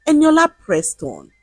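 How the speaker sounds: ordinary delivery, nothing marked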